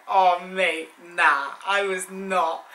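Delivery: loud, slow, cringing "nah, I was not"; mock horror. Real, not acted. Recalling her childhood photo to viewers with loud mock horror. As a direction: recoil at the memory, drawn out.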